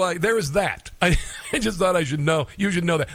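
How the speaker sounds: usual speaking voice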